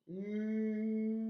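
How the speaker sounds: "Mi" très allongé, m puis i tenus; décomposer "Mi" en tenant chaque son; syllabe étirée. Shown as a demonstration. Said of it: "La syllabe mi". (« Mi » prolongé pour entendre chaque son)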